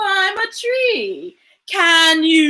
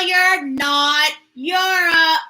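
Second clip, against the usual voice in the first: usual speaking voice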